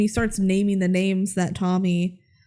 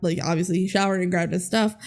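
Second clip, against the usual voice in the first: usual speaking voice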